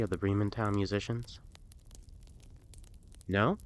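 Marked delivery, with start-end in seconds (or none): none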